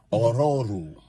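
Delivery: Ororu with rolled r's, African accent. Shown as a demonstration. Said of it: "you gotta say it in an African accent".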